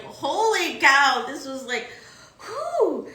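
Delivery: overwhelmed with awe, reliving it; amazed. Retold or imitated. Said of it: "And I was like".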